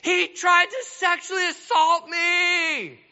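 high, loud accusing shout; wife screams a false accusation, high and loud. Acted. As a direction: scream it out to frame him. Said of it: "then she screams".

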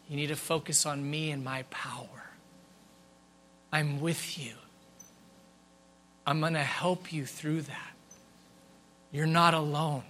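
soft, low, hushed reassurance with long pauses; whispering. Acted. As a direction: speak as God gently whispering comfort. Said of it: "in a whisper".

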